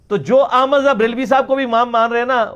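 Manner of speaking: ordinary talk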